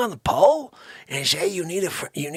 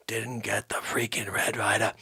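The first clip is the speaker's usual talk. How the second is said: ordinary talk, nothing marked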